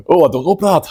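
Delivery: mimicking co-host's doubt in a hammed-up Scottish accent; mock Scottish accent. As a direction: parrot the co-host in a jokey Scottish accent. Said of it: "I don't know why you're Scottish".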